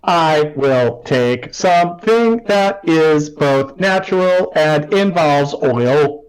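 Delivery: slow, even, mechanical delivery; robot voice. Acted. Kay the robot ordering food, slow and mechanical. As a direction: speak like a literal-minded machine. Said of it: "and is like".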